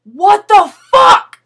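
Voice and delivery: seething at the unfair rule; angry inner rant. Retold or imitated. Silently fuming at the guard over the camera, loud indignant rant. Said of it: "In my head, I'm going".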